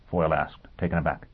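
usual speaking voice

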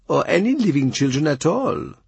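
high, careful foreign envoy voice; Chapuis voice. A narrator's reading. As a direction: Chapuis speaking with courtly hesitation. Ambassador voice again.

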